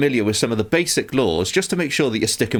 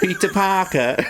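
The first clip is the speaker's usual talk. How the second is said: higher, laughing "Peter Parker" as "Peta Parka"; laughing British accent; 9 semitones higher, slower than usual. Retold or imitated. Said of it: "British people watching Spider-Man be like".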